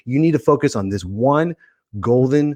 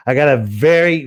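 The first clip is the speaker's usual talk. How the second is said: ordinary talk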